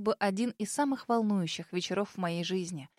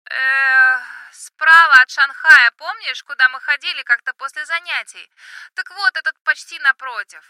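high, loud yell into the phone; shouting over music; 4 semitones higher, 13 dB louder than usual. Acted. Drunk friend shouting in club.

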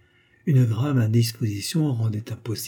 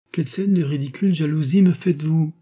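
higher-pitched scornful retort; indignant protest; 5 semitones higher, 4 dB louder than usual. A narrator's reading. Wife rebuffs her husband's jealousy, indignant. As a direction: brazen it out with indignation.

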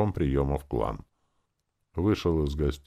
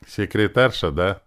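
louder, higher hostile question; 5 semitones higher, 6 dB louder than usual. A narrator's reading. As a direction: needle her with dislike. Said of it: "неприязненно спросил второй брат Иры".

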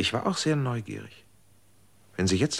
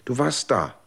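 urgent insistence; 6 semitones higher, 5 dB louder than usual. Acted. Insisting to friend she heard it.

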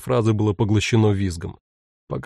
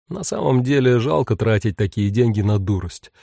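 usual speaking voice

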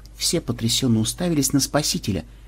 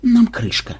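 higher, resigned remark; philosophical; 11 semitones higher, slower than usual. A narrator's reading. Officer sums up facing the pirate flagship, philosophically. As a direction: state doom as a calm observation. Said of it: "философски заметил Ксенобайт".

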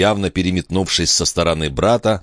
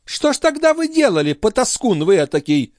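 high-pitched, indignant challenge; scolding countess. Acted. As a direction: berate him in a high lady's voice. Countess scolds her brother.

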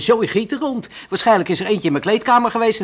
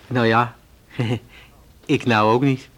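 embarrassed laugh; 5 semitones lower, slower than usual. Acted. Awkward admission about dinner.

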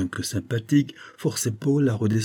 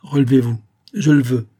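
composed command; 4 semitones higher, 7 dB louder than usual. A narrator's reading. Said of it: "dont l'émotion passagère disparut".

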